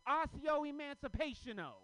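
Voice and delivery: high, loud, slow spell incantation; hammed-up spell, slow and high; kid casting a spell. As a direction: cast it slowly like a wizard. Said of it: "He goes".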